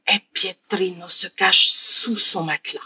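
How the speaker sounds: put-on hushed, soft narration of the boy hiding; whispering while hiding. A narrator's reading. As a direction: drop to a near whisper.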